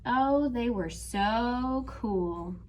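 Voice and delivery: usual speaking voice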